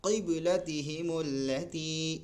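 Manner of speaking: قبلتہم اللتی chanted slowly; measured chant; slow recitation. Shown as a demonstration. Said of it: "ایک بڑا قاف ہوتا ہے".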